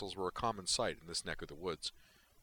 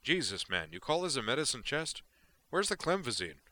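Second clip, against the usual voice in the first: higher, projecting complaint; raised, indignant voice; 7 semitones higher, 4 dB louder than usual. A narrator's reading. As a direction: call it out across the room in disbelief. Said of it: "raising his voice to a public discourse level".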